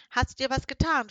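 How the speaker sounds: concerned, calling down to the cat; worried witch. A narrator's reading. Lulea calls down to her fallen cat, worried. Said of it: "fragte Lulea besorgt".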